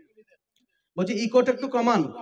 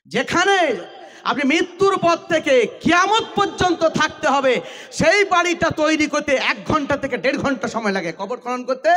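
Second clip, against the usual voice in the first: fiery preaching; 6 semitones higher than usual. Acted. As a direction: preach it with rising fervor. Preacher raising voice, full force. (জোরালো ওয়াজের সুর)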